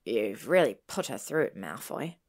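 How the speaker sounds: low, cold reproach. A narrator's reading. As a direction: reproach him coldly, dropping away on the name. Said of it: "Harry's voice was cold".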